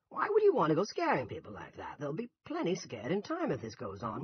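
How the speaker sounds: high, indignant tirade; angry. A narrator's reading. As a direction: scold in rising outrage. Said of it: "Ernie asked angrily".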